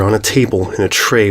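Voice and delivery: ordinary delivery, nothing marked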